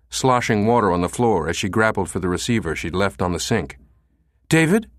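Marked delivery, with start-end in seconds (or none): 4.5-4.9 high, bright, rising hope; snatch the phone hoping it is him; hopeful. Acted. Susan answering, hoping David calls, high and eager.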